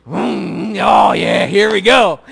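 loud excited whoop; a loud, thrilled whoop before hitting the gas; gleeful shout. Real, not acted. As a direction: gun it with glee. About to do donuts in snow.

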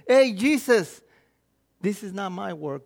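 ordinary talk

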